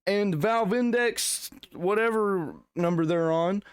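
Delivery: mock indignation. Streamer sarcastically defending Valve to viewers, mock indignant.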